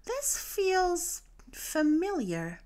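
slow and high, drawn out; Dory slowly recognizing the rubbish-filled bay; dreamy recognition. A narrator's reading. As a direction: let the words float up slowly.

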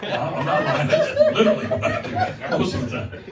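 laughing. Real, not acted. Laughs insisting he's not lying.

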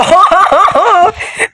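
high burst of guilty laughter; real laugh at an accident. Real, not acted. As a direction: crack up at your own blunder. Blew up his ally by accident.

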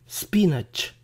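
"spinach" as SPEE-natch; wrong quiz option. Shown as a demonstration. Teacher offers a decoy choice, "spinach" as SPEE-natch. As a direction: say "spinach" with a long ee and full "natch". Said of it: "¿Cómo se pronuncia espinaca en inglés?".

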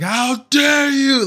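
anime outrage. Acted. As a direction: deliver it like an enraged anime hero. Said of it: "in cue anime Dragon Ball fashion".

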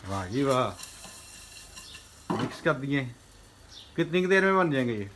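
ordinary talk, nothing marked

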